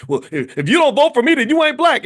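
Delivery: loud, high-pitched exasperated outburst; imitated angry retort, nearly shouted; mock blow-up. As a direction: blow up like a flustered politician. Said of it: "that's when he blew up and said".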